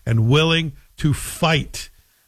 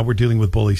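usual speaking voice

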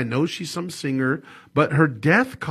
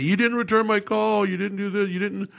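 higher, complaining voice; mad callers; 7 semitones higher than usual. Retold or imitated. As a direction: mimic annoyed clients nagging. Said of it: "I got some people mad at me".

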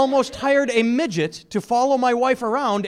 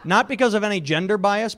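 normal talking voice

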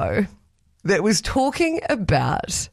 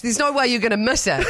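normal talking voice

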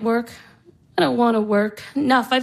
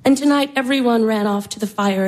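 ordinary talk

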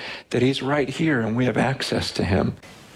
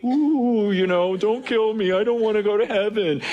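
mock fear; 5 semitones higher than usual. Pastor mocks a fearful believer facing death, hammed-up fright.

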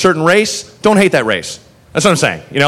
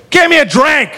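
put-on drunk demand; 10 semitones higher, 3 dB louder than usual. Drunk woman demands from doorway.

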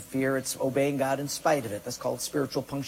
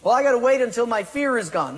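mock excuse; 6 semitones higher, 4 dB louder, faster than usual. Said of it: "courage is not the absence of fear".

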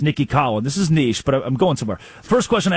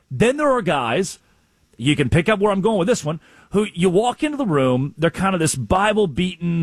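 ordinary delivery

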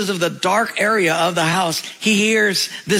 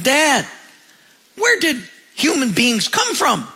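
put-on eager little boy; 6 semitones higher than usual. Boy calls father.

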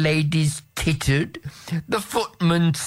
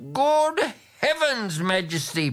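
higher, fussy noble protesting; a shocked aristocrat objecting; protesting Marquis; 11 semitones higher than usual. A narrator's reading. Marquis protests to the king, shocked and higher. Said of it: "protested the Marquis de Casaubon".

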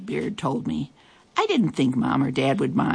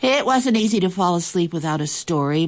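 ordinary delivery

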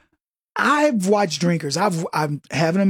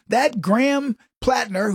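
ordinary talk, nothing marked

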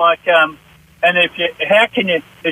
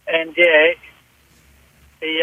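normal talking voice

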